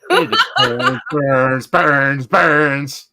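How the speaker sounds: loud, drawn-out repeated burns; playful hammy echo; mock burning cry. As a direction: chant it out loudly, stretching each word. Playfully echoing the burning joke.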